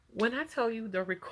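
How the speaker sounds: usual speaking voice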